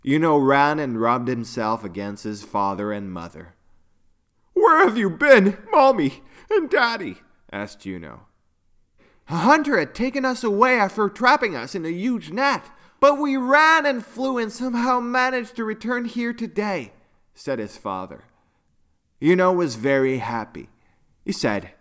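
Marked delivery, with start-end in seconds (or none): 4.5-7.2 put-on high-pitched young unicorn voice; child character. A narrator's reading. Yuno asks reunited parents where they were, high child voice. 9.3-16.9 high, animated character voice; storybook father recounting. A narrator's reading. Father tells Yuno of trapping and escape, high animated voice.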